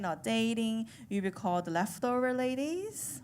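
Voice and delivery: ordinary talk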